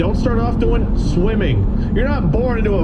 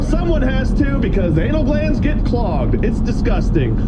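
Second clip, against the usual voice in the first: normal talking voice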